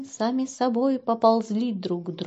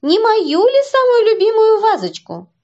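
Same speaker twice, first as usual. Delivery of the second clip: from another room; 8 semitones higher, 9 dB louder than usual. Acted. Mother shouting from kitchen.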